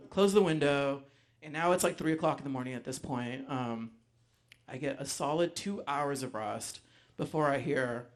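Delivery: usual speaking voice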